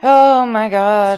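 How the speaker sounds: floored by what just happened; stunned exclamation. Real, not acted. Co-host reacting to the stream's ending, slow stunned outburst.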